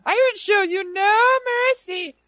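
very high squeaky tiny-guy voice; high-pitched voice. Retold or imitated. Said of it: "this one already has a high-pitched voice".

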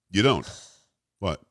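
ordinary delivery

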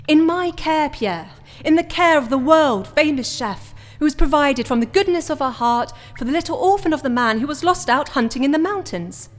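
furious, taunting rant; menacing outburst. Acted. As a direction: rise up and lord it over him. Threatening Pierre about his daughter.

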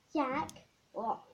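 usual speaking voice

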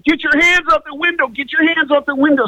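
high, fast mock command; urgent, high-pitched cop imitation; deputy's order. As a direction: rap out a repeated police order. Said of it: "And he's like".

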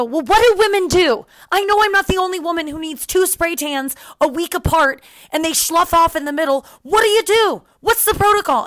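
high, loud, incredulous demand; exasperated complaint. Retold or imitated. To the tanning staff about her tan, a high loud exasperated demand. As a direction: sound fed up and baffled at the staff.

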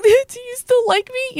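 hammed-up pleading for reassurance; mock needy whine. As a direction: exaggerate clingy insecurity in a slow high voice. Acting out her needy question again.

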